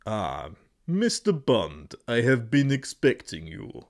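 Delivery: theatrical spy-film villain; Bond villain. Shown as a demonstration. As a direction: greet your nemesis like a film villain.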